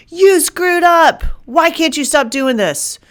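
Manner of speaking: high, loud scolding inner critic; harsh accusing voice. Retold or imitated. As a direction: berate yourself like a harsh accuser. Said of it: "That is not the voice of God".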